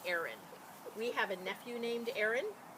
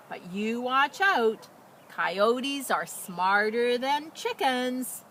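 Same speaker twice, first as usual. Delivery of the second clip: slow, louder cautioning; gentle warning; 3 semitones higher, 8 dB louder, much slower than usual. A narrator's reading. Farmer's wife warning the hen Emma, slow and cautionary. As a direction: warn the hen slowly and kindly. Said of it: "said the farmer's wife".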